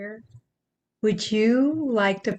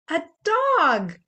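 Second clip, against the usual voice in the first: usual speaking voice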